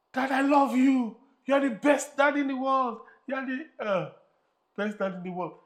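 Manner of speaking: put-on high, loud delighted kid; mock ecstatic child; overjoyed boy. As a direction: burst with childish joy. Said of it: "And the boy was like".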